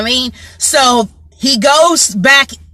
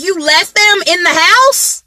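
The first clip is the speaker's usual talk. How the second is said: accusing brother; 6 semitones higher than usual. Retold or imitated. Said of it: "He's like".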